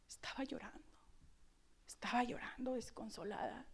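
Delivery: hushed, breathy whisper of grief; soft unvoiced whisper, mournful. A narrator's reading. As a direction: drop to a whisper over her weeping. Preacher describes Mary weeping.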